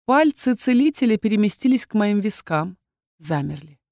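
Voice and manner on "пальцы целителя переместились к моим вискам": ordinary delivery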